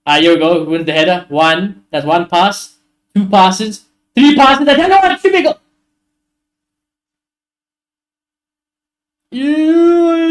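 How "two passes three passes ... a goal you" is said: counting passes, getting higher and louder with each; rising excitement. Real, not acted. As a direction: build up into a thrilled shout as the chance comes. Counting passes then near goal.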